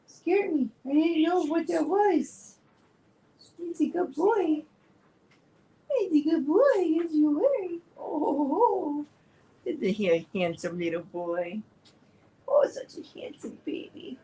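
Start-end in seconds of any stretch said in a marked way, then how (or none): 0.1-2.6 high sing-song pet voice. Real, not acted. Talking to her dog after a scare, high baby voice. 3.6-9.1 high cooing praise for the dog; doting pet talk. Real, not acted. Praising her good dog, high doting coo. 12.5-14.2 soft whispered praise; whispered cooing. Real, not acted. Whispering praise to handsome dog.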